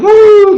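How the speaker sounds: high, loud "woo" sound effect; imitated falling whoosh, high and loud; whooping plunge noise. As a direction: whoop it high like something plummeting.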